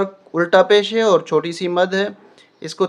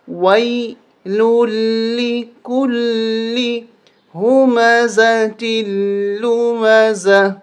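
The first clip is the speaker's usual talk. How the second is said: slow chanted recitation; Quranic recitation; 4 semitones higher, much slower than usual. Shown as a demonstration. Teacher recites the verse and pauses at the stop. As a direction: recite the verse with a melodic lilt. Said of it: "ہم نے یہاں وقف کیا".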